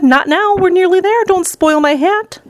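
high-pitched, anxious begging; alarmed plea. A narrator's reading. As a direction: plead to save your precious hat. Wonka pleading with queasy passenger.

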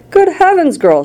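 high-pitched, contained shriek. A narrator's reading. Mother alarmed at her daughter, high narrated shriek. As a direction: jump high in alarm, volume held back. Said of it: "shrieked Missus Beauregard".

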